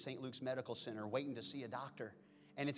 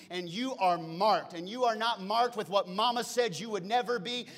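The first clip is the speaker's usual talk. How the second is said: loud, high, rising sermon delivery; preacher declaring to the church; preaching hard; 5 semitones higher, 9 dB louder than usual. Acted. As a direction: build it with full preaching force.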